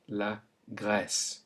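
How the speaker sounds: slow la Grèce with open è; la Grèce slowly; French è. Shown as a demonstration. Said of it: "how it makes the E sound".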